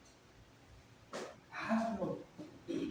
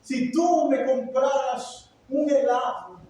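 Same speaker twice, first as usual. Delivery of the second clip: high, loud, slowly intoned plea of a child imitating pious prayer; mock prayer voice; 7 semitones higher, 5 dB louder, slower than usual. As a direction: stretch it out like an over-solemn prayer. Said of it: "¿para qué fingimos la voz?". (súplica de niño con voz de rezo fingida, aguda y alargada)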